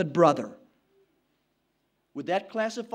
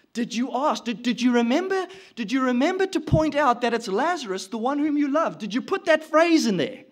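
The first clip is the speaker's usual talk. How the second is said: higher, pressing questions to the messenger; anxious questioning; 3 semitones higher, 5 dB louder than usual. Acted. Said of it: "You can see them asking the messenger".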